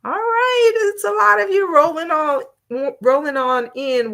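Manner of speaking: pleased exclamation as viewers pour in; delighted surprise. Real, not acted. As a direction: lift up in happy amazement. Surprised at many viewers joining.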